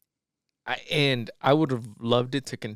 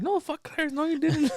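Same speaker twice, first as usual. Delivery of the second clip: much higher imitated outburst; mock angry dismissal. Him refusing to believe Clarence, mock angry dismissal. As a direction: cut him off in pretend outrage.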